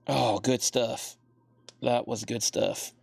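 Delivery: winded after stretching; out of breath. Real, not acted. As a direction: breathe hard between words. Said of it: "I'm out of breath from stretching".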